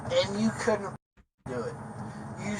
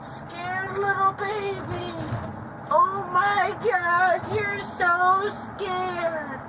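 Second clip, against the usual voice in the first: mocking taunt; 10 semitones higher, much slower than usual. Real, not acted. Mocking a scared man in car.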